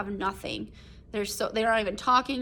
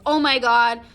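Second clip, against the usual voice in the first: shocked exclamation; 2 semitones higher, 7 dB louder than usual. Retold or imitated. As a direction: exclaim it, then it sinks in. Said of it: "the big moment of, like".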